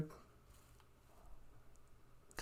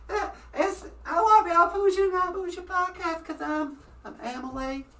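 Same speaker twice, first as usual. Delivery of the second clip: high, small puppet voice, much higher than his own; Emily puppet voice; 8 semitones higher, 10 dB quieter than usual. Acted. Host giving his puppet Emily a high voice. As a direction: squeak out a shy little puppet girl.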